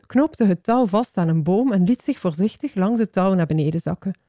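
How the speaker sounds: usual speaking voice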